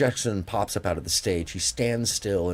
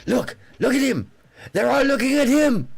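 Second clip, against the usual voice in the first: very high, loud, awestruck shouting; 6 dB louder than usual. Retold or imitated. Imitating the singer marvelling at a star onstage, excited high shouting. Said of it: "I'll say it like him".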